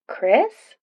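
tentative rising question. A narrator's reading. Katie answering Chris's call, lightly questioning.

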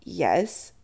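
usual speaking voice

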